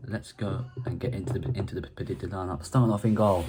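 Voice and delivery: ordinary talk, nothing marked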